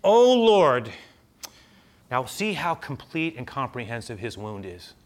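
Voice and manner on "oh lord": loud, high, drawn-out plea; hammed-up pleading. Moses pleading with the Lord, theatrically imploring. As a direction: wail it like an overdone beggar. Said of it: "Moses pleaded with the Lord".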